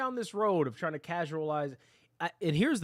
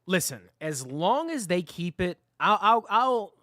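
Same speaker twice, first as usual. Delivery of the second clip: loud, emotional stammering rant; worked up; 3 semitones higher, 6 dB louder than usual. Real, not acted. Streamer ranting about casual playlists, really worked up. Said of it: "I've been worked up".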